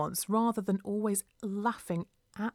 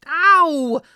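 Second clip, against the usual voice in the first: loud, high cry of pain; wail of agony; 9 semitones higher, 15 dB louder than usual. A narrator's reading. Dancer crashes out of a pirouette, wailing in agony. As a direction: yell "ow" in agony. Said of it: "I wail in agony".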